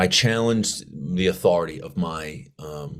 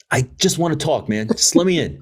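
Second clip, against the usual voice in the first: coaxing plea; 8 semitones higher, 3 dB louder than usual. Retold or imitated. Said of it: "I'm like".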